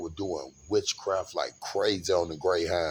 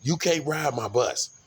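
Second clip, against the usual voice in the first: a raised, stern bus driver telling him off; 6 semitones higher than usual. Retold or imitated. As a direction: lay down the rule like a strict driver. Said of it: "the way he was so stern".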